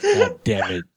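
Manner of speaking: high laughing yell of defeat; laughing shout. Real, not acted. Losing the guessing game.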